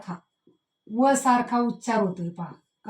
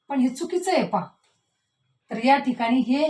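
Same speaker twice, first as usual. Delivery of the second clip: normal talking voice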